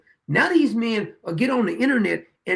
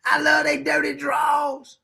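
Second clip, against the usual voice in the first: higher, slow, gushing fan voice, swelling to a shout; mock adoring fan; 6 semitones higher, slower than usual. Mocking fans who adore their politicians, gushing. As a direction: gush like a smitten supporter. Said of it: "but they'll still".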